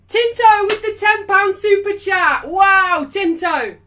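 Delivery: loud, high, drawn-out announcement; excited shout-out. Real, not acted. Streamer thanking a viewer for a super chat, excited shout-out. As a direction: hype up the donation like a big win.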